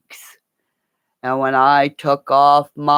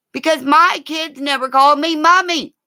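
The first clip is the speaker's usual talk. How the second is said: excited aside; 13 semitones higher, 4 dB louder, faster than usual. Real, not acted. Excited aside while imitating.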